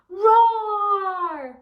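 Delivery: loud, high roaring shout; lion roar. Shown as a demonstration. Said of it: "roar like a lion".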